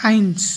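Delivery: আইনস said as German "eins"; German pronunciation. Shown as a demonstration. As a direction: teach the German number আইনস clearly. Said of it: "ডয়েসে একে বলবো আমরা". (আইনস, German উচ্চারণে)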